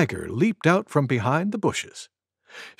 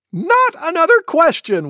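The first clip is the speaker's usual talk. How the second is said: high, loud mock wail; 6 dB louder than usual. A narrator's reading. Cuckoo begging Monkey to stop asking, wailed. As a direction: wail it out in exasperation. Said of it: "wailed Cuckoo".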